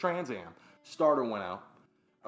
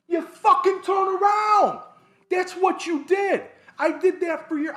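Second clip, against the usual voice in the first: exasperated shout; 11 dB louder than usual. Real, not acted. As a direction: yell it high and very loud. Exasperated answer about reversing without camera.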